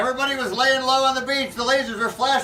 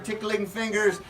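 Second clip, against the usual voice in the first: plain reading voice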